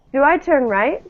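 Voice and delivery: "do I turn right?" lifting up on "right"; rising question. Shown as a demonstration. Teacher prompting Joao with a rising question. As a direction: model the rise for Joao. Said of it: "uh, Joao".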